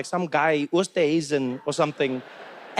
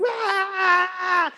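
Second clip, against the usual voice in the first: high-pitched starstruck shriek; hammed-up high scream; mock fan scream; 13 semitones higher than usual. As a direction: imitate a hysterical fan's squeal. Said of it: "no one's like".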